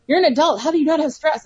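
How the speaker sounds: lightly voiced baffled youngster; incredulous child. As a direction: mimic a child who can't believe it. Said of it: "Coral, who's eleven".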